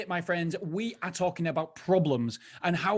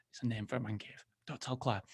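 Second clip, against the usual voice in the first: dropped to a much softer, lower murmur; hushed joking aside, much softer; conspiratorial aside; 6 semitones lower, 11 dB quieter than usual. Real, not acted. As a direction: tell it like a secret your wife mustn't hear. Aside hiding from his wife.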